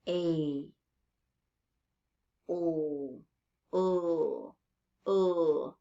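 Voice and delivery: "A, o, u, u" each drawn out alone; bare sustained vowels, "A", then "o", "u", "u"; isolated vowel sounds. Shown as a demonstration. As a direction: hold each vowel of "A, o, u, u" with pauses between. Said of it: "that uh sound".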